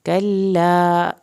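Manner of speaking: "کلا" very slow, with a long final a; stretched vowel. Shown as a demonstration. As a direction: draw out the end of "کلا". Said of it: "الف کو کھینچ کے پڑھیں".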